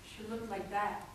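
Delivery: low, soft, restrained reveal; quiet statement. Acted. Poet showing an audience how she looked, quiet. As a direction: say it low, holding back. Quiet first take before escalation.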